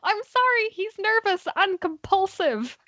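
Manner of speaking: high-pitched excuse to the guards; apologetic character. Acted.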